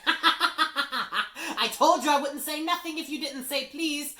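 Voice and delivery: very high, loud, slow cackling gloat; laughing. A narrator's reading. Peeves gloats while laughing.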